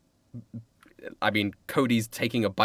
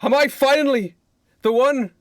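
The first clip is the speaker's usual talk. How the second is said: hammed-up stage soliloquy, rising higher; William Shakespeare; 9 semitones higher, 3 dB louder than usual. Playwright agonises over who claims the kill, grand declaiming. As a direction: declaim it theatrically with long pauses, building high. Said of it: "William Shakespeare goes".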